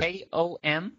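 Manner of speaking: slow, letter-by-letter spelling; dictating. Real, not acted. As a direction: spell each letter out clearly. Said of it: "I'll spell it out for you".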